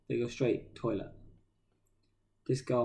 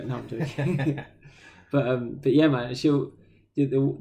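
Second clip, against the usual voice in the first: usual speaking voice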